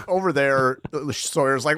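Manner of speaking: usual speaking voice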